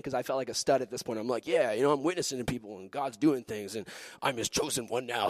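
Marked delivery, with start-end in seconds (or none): 1.5-5.3 low, soft, smug self-talk; cocky punk. Retold or imitated. Young witness boasting to himself, cocky punk voice. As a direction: play it self-satisfied and too cool. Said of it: "Just being a punk".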